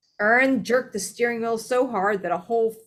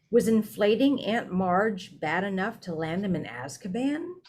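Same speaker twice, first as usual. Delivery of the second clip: usual speaking voice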